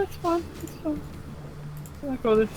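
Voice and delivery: normal talking voice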